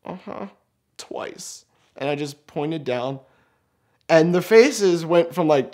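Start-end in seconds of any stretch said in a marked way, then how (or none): 0.1-1.7 high uh-huh sinking to near whisper; sheepish pained admission. Retold or imitated. Stung man confessing to friends, tiny hushed voice. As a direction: barely get it out, very softly.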